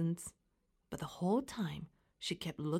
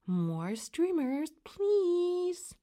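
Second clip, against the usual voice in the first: drawn-out prompt, rising high on PLEASE; reminding; 9 semitones higher, 4 dB louder, much slower than usual. A narrator's reading. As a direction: remind a child of manners, stretching please. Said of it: "Mom reminded her".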